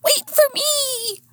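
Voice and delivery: very high, drawn-out plea; trailing sidekick. A narrator's reading. Sidekick running after the heroine, high-pitched calling. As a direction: call out slowly in a high little voice.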